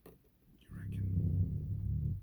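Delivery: slow whispered Australian "reckon"; hushed, drawn-out Aussie "reckon"; whispered mock Aussie. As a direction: breathe "You reckon?" out slowly like a mocking Aussie. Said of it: "The fuck you mean I reckon?".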